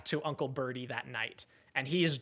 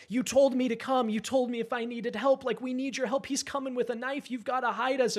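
desperate plea; 9 semitones higher than usual. Retold or imitated. Boy pleading with drunk uncle.

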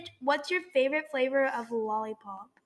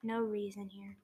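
whispering; 4 semitones lower, 15 dB quieter than usual. Real, not acted. Whispered aside.

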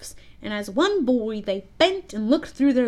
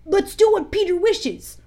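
put-on high, loud boys' agreement; eager cry; 9 semitones higher, 5 dB louder than usual. A narrator's reading. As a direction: call out in eager obedience. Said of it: "cried the simple boys".

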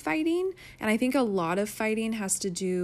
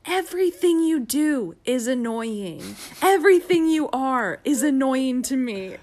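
snappy outburst; 4 semitones higher, 8 dB louder than usual. Retold or imitated. Wife cooking, snapping loudly at husband in kitchen. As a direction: snap it out like you're fed up. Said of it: "I was cooking, and I was like".

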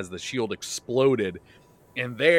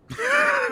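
a sudden burst of loud high laughter; big laugh; 4 dB louder than usual. Real, not acted. Laughing loud at the dwarf's offer of help. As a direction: crack up at the story. Host cracks up at own story.

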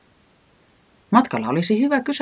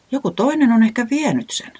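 doubtful; 10 semitones higher than usual. A narrator's reading. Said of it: "epäili Reporankka".

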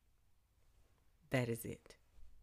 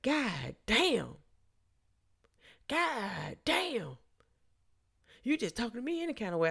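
riled-up man; 8 semitones higher than usual. Retold or imitated. Drunk man griping at woman who refused, mock-indignant. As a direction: imitate his exasperation, pitched up. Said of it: "His voice is starting to raise".

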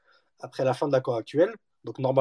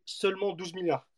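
sarcastic air quotes; 4 semitones higher, 6 dB quieter than usual. Acted. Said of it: "j'ai fait des guillemets avec mes mains".

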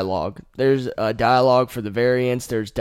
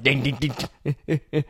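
mock ding sounds; 2 semitones higher, much slower than usual. Lunatic beating head on wall.

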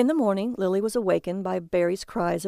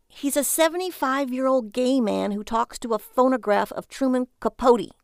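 high, loud, exasperated wife voice; 5 semitones higher, 4 dB louder than usual. Acted. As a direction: spell it out in disbelief.

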